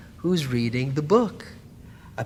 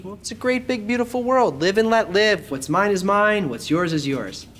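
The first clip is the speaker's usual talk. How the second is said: sing-song mock cheer, pitched much higher; breezy mock optimism, pitched way up; mock carefree; 6 semitones higher, 3 dB louder than usual. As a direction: parody a smug self-centred outlook. Mocking the self-centred me.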